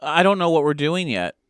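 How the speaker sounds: ordinary delivery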